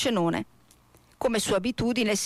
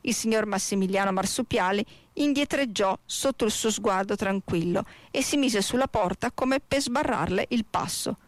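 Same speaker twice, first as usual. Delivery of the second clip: usual speaking voice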